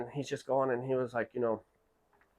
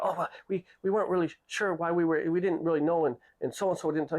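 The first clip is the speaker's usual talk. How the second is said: flustered excuses; 6 semitones higher than usual. Retold or imitated. Employee making flustered excuses.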